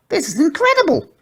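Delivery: high pug voice, delighted; squealing pug. A narrator's reading. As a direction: squeal with flying wonder. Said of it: "squealed Porky".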